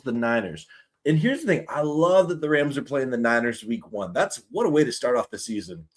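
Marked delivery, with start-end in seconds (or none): none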